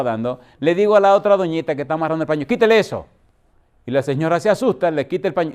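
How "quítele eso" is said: sharp command. Retold or imitated. Pastor orders cloth removed.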